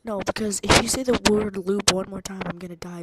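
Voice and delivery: loud mock warning; mock threat. Repeating the warning to the AI, loud. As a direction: push it out like an ultimatum.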